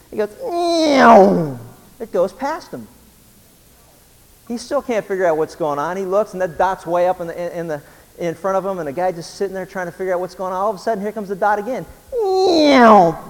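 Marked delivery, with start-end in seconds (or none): 0.4-1.6 high, loud "meow" of a whizzing moped; moped zooming past. Retold or imitated. 12.1-13.2 high, loud "meow" of a whizzing moped; moped zooming past. Retold or imitated.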